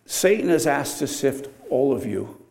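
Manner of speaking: ordinary talk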